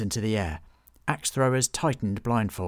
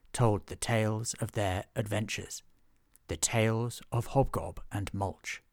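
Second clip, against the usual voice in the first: storybook opening. A narrator's reading. Narrator opening the tale for listeners, storybook cadence. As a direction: set the scene like a bedtime story.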